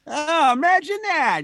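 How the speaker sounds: high, loud sarcastic gasp; mock amazement, dripping sarcasm. Sarcastic reply.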